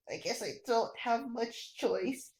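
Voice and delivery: usual speaking voice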